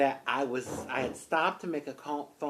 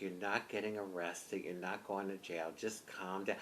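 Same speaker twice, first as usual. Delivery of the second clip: soft, low confidential murmur; hushed reassurance; 4 semitones lower, 11 dB quieter than usual. Retold or imitated. Officer reassuring the upset driver, whispering. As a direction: quietly talk someone down, imitating him. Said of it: "whispering to me".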